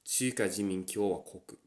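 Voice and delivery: Chuka Jinmin Kyowa Koku in Japanese sounds; Japanese pronunciation. Shown as a demonstration. Teaching a Japanese country name, careful pronunciation. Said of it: "to say the People's Republic of China".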